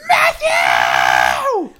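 low, loud scolding yell of the name; angry mom shout. Retold or imitated. Matt imitating his mom yelling his name, low and loud. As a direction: bark the name like a furious mom.

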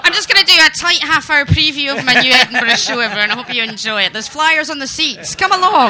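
loud, hammed-up show plug; comedy show announcer. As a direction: announce it like a gig host. Mock stand-up show plug.

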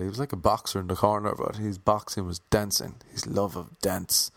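usual speaking voice

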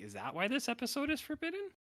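imitating his own confused reaction; incredulous. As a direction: play up your own disbelief. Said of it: "and I was like".

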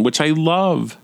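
ordinary talk, nothing marked

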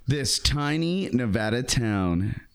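headline read. Shown as a demonstration. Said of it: "Secretive, secretive, or secretive?".

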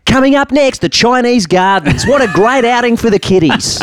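high, bouncy travel show presenter; TV host voice. Pretend. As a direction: sell it like a cheesy tour host.

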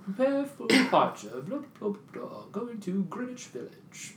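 high, slow announcer drone; mock train announcer. Imitating the subway announcement to friends, lightly mocking. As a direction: parrot the platform announcement. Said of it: "you know".

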